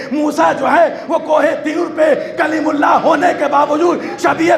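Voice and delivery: high, ringing build to a climax; sustained high-pitched oratory, insistent and driving; impassioned preaching. Real, not acted. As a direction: drive each phrase home at full sermon force.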